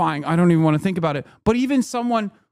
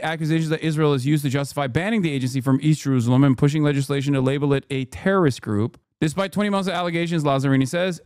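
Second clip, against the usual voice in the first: ordinary talk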